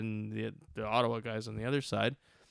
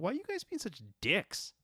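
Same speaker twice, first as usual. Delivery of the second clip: indignant; 9 semitones higher, 5 dB quieter than usual. Retold or imitated. What he felt like saying.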